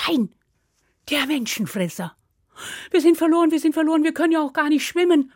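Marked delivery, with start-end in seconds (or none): 2.9-5.3 high, hurried cry of despair; panic, words tumbling out higher and louder; panicked wail. Acted. Little brother trapped at the river, panicking.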